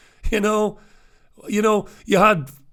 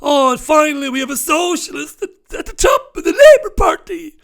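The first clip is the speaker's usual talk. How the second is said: high, loud, gushing mock cheer that breaks into a shout; mocking delighted fan; 11 semitones higher, 7 dB louder than usual. Mocking Labour fans over the new leader, gushing shout. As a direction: ham up the fan's joy at their new leader. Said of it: "you're delighted".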